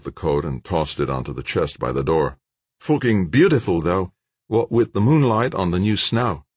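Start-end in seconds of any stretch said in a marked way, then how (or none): 2.8-6.4 higher and brighter, a big Aussie bloke talking up the night; young Aussie George. Acted. George's voice in the story.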